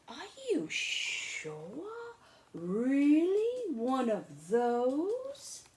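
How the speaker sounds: Charlie aghast. Acted. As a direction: stretch every word in shock. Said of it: "And I said".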